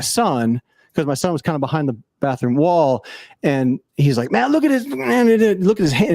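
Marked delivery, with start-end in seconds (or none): 4.3-6.1 much higher-pitched mimicked grumble; imitated complaining man. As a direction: imitate a guy griping, pitched way up. Retelling a cursing man's complaint.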